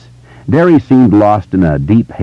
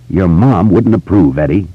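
usual speaking voice

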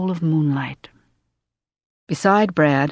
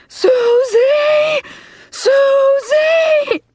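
loud, very high drawn-out scream; 4 dB louder, much slower than usual. A narrator's reading. Mother screaming for her daughter, high and frantic. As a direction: scream the name at full pitch, stretching it out. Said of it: "Then she was screaming, too".

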